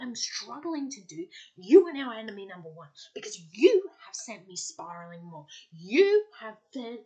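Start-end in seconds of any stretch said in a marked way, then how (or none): 1.6-2.9 loud and fast, punching it; loud declaration. Real, not acted. To the friend telling her to think positively, loud and fast. 3.1-7.0 louder scolding. Real, not acted. Scolding the unhelpful friend.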